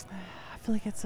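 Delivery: breathy whispered start with a sigh. Real, not acted. Cohost sighs a whispered verdict on the accent tweets.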